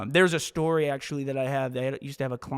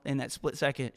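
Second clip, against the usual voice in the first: normal talking voice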